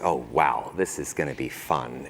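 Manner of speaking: low, quiet, deadpan sarcasm; dry sarcasm. Retold or imitated. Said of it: "you're like".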